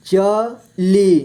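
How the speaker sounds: चली split slowly into च, ली; syllable by syllable. Shown as a demonstration. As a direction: stretch and separate च and ली in चली. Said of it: "इस तरह से आपको". (चली को तोड़कर धीरे: च, ली)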